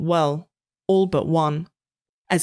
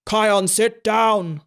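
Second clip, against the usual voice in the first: slow bellow; 4 semitones higher, much slower than usual. A narrator's reading. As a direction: bellow the order. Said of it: "Cook bellowed".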